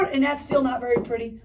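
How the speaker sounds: usual speaking voice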